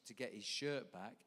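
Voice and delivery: ordinary talk, nothing marked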